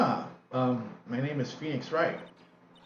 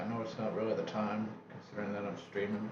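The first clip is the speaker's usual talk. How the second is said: normal talking voice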